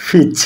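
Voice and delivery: ফিচ opened with a breathy p; aspirated p in peach. Shown as a demonstration. Teacher repeating the aspirated ফিচ for students. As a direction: puff the air out on ফিচ. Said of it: "উচ্চারণ হবে কি".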